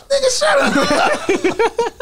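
very high, very slow laughing shout; laughing disbelief. Retold or imitated. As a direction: squeal it through laughter. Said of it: "And I was like".

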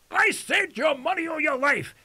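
hammed-up holdup man losing patience; a crook pressing the demand again, mock menace; impatient robber. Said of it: "And the guy says".

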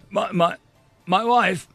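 high, halting disbelief; shocked stutter. Acted. Grice hears the prisoner mention his wife, stuttering in shock. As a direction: stumble on the first word, taken aback. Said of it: "Grice stuttered".

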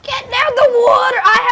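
real exasperated yell; frustrated shout. Real, not acted. Struggling with boats in game.

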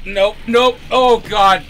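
high, loud panicked yelling; panicked yelp. Real, not acted. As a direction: yelp in real alarm as the car goes. Car about to crash, panics.

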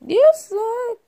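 shouted taunt, punchy; loud taunt. Pretend. Toy fighter taunting a rival, shouting. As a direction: hurl the insult.

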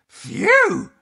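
high, loud "phew"; exaggerated storybook relief; relieved phew. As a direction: blow out relief in one big burst. Dog relieved at the escape.

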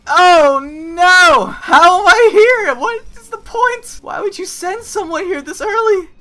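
high, loud panicked cries; panicked shout. Real, not acted. Player dumped in a deadly area, high panicked yelling. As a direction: wail in alarm at where you ended up.

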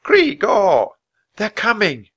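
high, loud alarmed caw; warning call. Acted. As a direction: warn him the guards are coming. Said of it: "Annie called another warning".

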